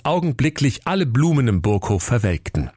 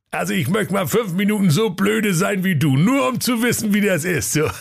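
high, mocking workman voice; cheeky workman, teasing him; cheeky sewer worker; 10 semitones higher than usual. (frecher Kanalarbeiter, spöttisch)

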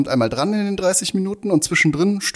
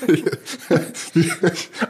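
laughing question; 4 semitones higher than usual. Real, not acted. Mocking a slip of the tongue.

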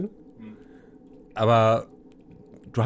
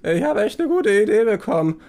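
higher-voiced, pleased revelation; bewitched prisoner; 10 semitones higher, 5 dB louder than usual. Prisoner under whispered suggestion, naively delighted. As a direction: announce it like a dim cellmate struck by inspiration.

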